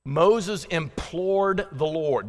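slowly, each word drawn out; slow careful reading. Shown as a demonstration. Preacher slowing to read a tricky verse, slow and deliberate. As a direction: spell out the hard words slowly. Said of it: "That's hard to say real fast".

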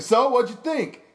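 high, loud and expectant; eager question. Retold or imitated. Comic asking Amir K after the show, eagerly. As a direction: pitch it up, fishing for approval.

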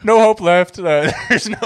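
loud, high mock doomer; Stephen Hawking. Friend retelling comedian's bit as doomer scientist, loud high impression. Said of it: "He's like".

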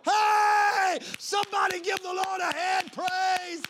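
loud, high shouted preaching; drawn-out pulpit shout; full-force preaching. Real, not acted. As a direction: thunder God's words at the congregation.

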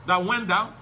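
ordinary delivery

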